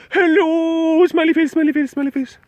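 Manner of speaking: high, bright chirpy hello; bubbly greeting. Pretend. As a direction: chirp it like an emoji come to life. Reading cheerful chat greeting aloud.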